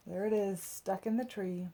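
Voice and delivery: ordinary delivery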